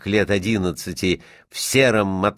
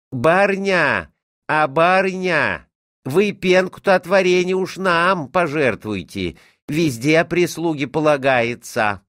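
wheedling cook; 8 semitones higher, 4 dB louder than usual. A narrator's reading. Cook begging for jam froth from the doorway, wheedling. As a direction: coax the mistress in a singsong call.

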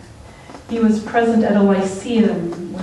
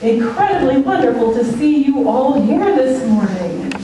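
ordinary talk, nothing marked

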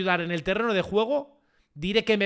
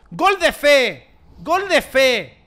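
loud, slow, raised chant-like call; fervent cry; 4 semitones higher, 7 dB louder, much slower than usual. Real, not acted. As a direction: stretch it out like a rallying cry. Urging a goal in game.

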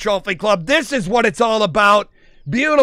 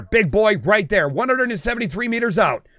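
usual speaking voice

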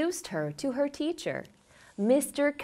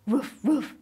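playful woof; 3 semitones higher than usual. Pretend. Imitates dog bark.

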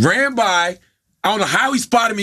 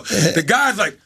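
ordinary talk, nothing marked

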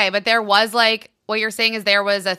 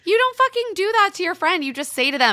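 indignant, pitched way up; furious; 12 semitones higher than usual. Real, not acted. Listener fuming about the friend who vanished, pitched high. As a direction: vent real outrage at the friend. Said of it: "now I'm just furious at Evelyn".

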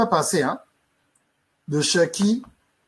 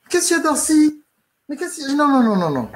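aiguë et insistante, plus forte; la femme qui presse de questions; voix de la femme; 10 semitones higher, 4 dB louder than usual. Acted. La femme inquiète l'interroge. (voix de femme aiguë et pressante)

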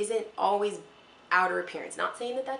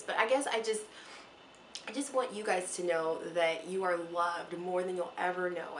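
ordinary talk, nothing marked

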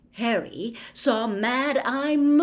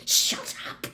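voiceless, cutting hiss. A narrator's reading. Teacher silencing the babbling caretaker, a sharp hiss. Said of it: "Snape hissed to Filch".

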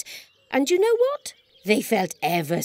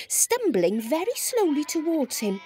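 usual speaking voice

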